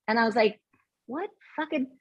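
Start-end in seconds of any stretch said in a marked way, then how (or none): 1.1-1.9 muttered aside. Retold or imitated. Thinking to herself about him.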